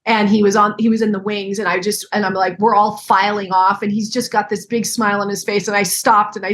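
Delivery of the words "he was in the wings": ordinary delivery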